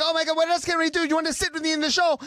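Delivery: high, fast, imitated excitement; eager gushing. Star inviting him to the show, eager gushing.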